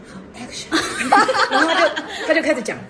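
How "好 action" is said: breathy giggling whisper, much softer; a timid little whispered action call; whispered cue. Retold or imitated. Wife cues recording timidly. (小声气音说好action)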